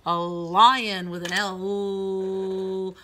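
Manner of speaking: drawn out, loud "A lion with an L"; slow stretched word. Shown as a demonstration. Teacher to children, stretched slow. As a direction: stretch "lion" slowly for young readers. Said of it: "teacher stretching out the word".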